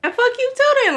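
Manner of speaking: high retort. Retold or imitated. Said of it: "And I'm like".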